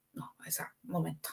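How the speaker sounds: a hushed, breathy whisper of the officer's words; whispered quote. Retold or imitated. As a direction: drop to a secret whisper. Canaris quoted deciding her fate. (cita susurrada en voz muy baja)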